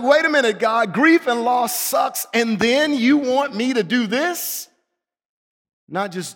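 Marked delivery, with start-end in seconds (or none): 0.0-4.7 high, incredulous objection to God; indignant protest. Acted. Preacher objecting to God's command while grieving, indignant. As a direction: push back in raised-pitch disbelief.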